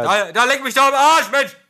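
high, loud excited outburst; excited exclamation. Real, not acted. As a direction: burst out in delight. (begeisterter Ausruf)

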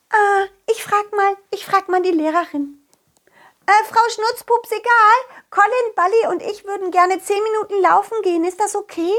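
put-on high fairy child voice. Fairy asks the teacher.

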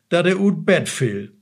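ordinary talk

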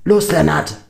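loud, high urgent call; anfeuernder Ruf. A narrator's reading. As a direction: call out sharply to someone in a fight. Shouted call in the fight. (lauter, drängender Zuruf)

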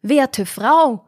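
man calling after a woman leaving the store; calling after her. A narrator's reading. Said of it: "rief ihr der Mann nach".

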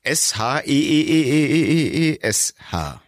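letter-by-letter, stuck on the E; drawn-out spelling. Shown as a demonstration. Spells word with repeated letters.

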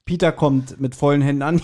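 ordinary talk

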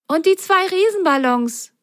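put-on high eager girl voice; Lili's voice. A narrator's reading. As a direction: add it with growing excitement. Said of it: "ergänzte Lili".

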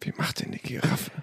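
hushed whispered question. Real, not acted. (flüsternd)

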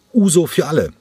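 high, loud call for a round; cheerful call. Acted. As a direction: shout the round out to the whole bar. Cranko calls out a round. (fröhlicher Ausruf, hoch und laut)

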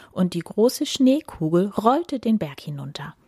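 ordinary delivery, nothing marked

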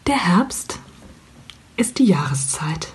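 slow, hushed, half-whispered poetic reading; poetic closing line. Shown as a demonstration. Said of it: "[name], bitte".

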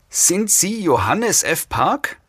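slow, high female voice; high-pitched, measured questioning; interrogating woman. A narrator's reading. Monotone woman questions captive.

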